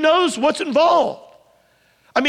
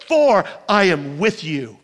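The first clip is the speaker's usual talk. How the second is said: lower, gentle promise; tender promise; 6 semitones lower than usual. Acted. God's promise quoted.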